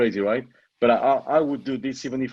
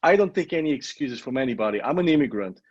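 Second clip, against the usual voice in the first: ordinary talk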